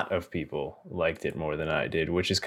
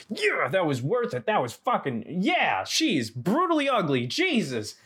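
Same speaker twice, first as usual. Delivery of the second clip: mock horrified reaction; 10 semitones higher than usual. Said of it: "And you're like".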